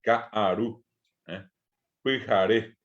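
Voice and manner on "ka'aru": kaaru said as ka, short stop, aru; glottal break in kaaru. Shown as a demonstration. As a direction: cut the voice between the two a's of kaaru. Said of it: "Fíjense que dije ka-a". (kaaru con corte entre las dos aes)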